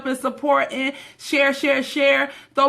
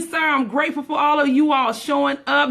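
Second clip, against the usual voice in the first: normal talking voice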